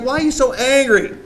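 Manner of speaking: a hint of a weak pastor begging; mock whiny Aaron. Aaron voiced pleading to Moses.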